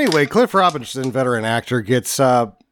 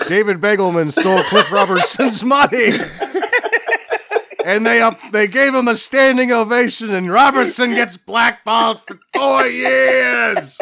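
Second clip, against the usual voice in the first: higher voice, squeezed by laughter; laughing while reading; 4 semitones higher than usual. Real, not acted. Host finishing the story of the blackballed actor, cracking up. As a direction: push through the quote as it dissolves into laughter.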